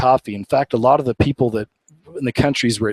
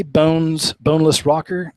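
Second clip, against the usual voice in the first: usual speaking voice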